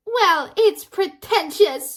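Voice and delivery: high, loud, drawn-out jab; mock snooty retort. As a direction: stretch it out like a haughty comeback. Sister retorts to Colin.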